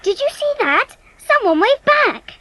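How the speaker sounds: high, wide-eyed disbelief; astonished delight; amazed. Acted. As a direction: marvel at what just happened. Child amazed the passenger waved.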